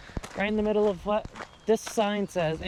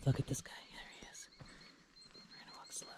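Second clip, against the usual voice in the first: wildlife watching whisper; 16 dB quieter, faster than usual. Real, not acted. Sneaking up on beaver.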